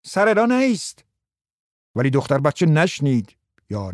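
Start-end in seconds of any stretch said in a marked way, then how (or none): 0.1-1.0 put-on high, sharp call to the child; shout. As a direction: call out over the court. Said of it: "داد زد". (فریاد بلند)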